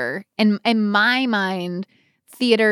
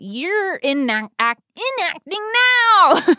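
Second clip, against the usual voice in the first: drawn-out high playful tease; slow, high ribbing of a friend; teasing; 10 semitones higher, 5 dB louder, slower than usual. Real, not acted. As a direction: stretch it out mockingly.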